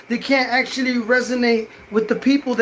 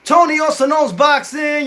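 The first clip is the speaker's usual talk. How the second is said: higher and louder, shouting Tony Also Knows Boxing; channel name shout; 6 semitones higher, 4 dB louder than usual. Real, not acted. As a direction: belt out your channel name like a catchphrase. Shouting his channel catchphrase again.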